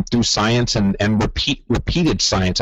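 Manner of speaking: normal talking voice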